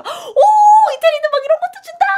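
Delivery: high, loud, fast excited squeal; delighted squeal. Retold or imitated. Teacher retelling her glee at free bread in Italy, squealing. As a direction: gush like a thrilled tourist. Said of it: "그래서 내가".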